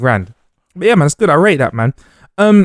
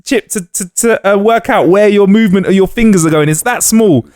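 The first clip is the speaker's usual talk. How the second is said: higher-pitched, excited enthusiasm; real excitement, stretching key words high; over-excited; 8 semitones higher than usual. Real, not acted. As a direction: get carried away pitching a gadget. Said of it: "I got a bit too excited there".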